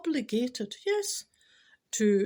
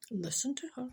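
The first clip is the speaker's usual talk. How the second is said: unvoiced whispered aside, softer; 3 semitones higher, 6 dB quieter than usual. Real, not acted. Whispering an aside to the podcast listeners. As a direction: lean in and whisper a private aside.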